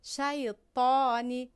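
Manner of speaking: Arabic ṭā in "شیطانی", tongue pushed up; heavy emphatic T. Shown as a demonstration. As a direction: draw out "شیطانی", darkening the ṭ.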